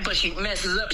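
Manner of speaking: usual speaking voice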